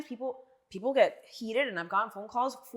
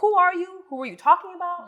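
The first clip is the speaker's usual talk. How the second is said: incredulous retold question; 8 semitones higher, 5 dB louder than usual. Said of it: "And I was like".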